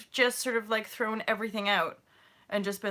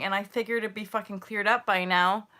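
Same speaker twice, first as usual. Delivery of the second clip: usual speaking voice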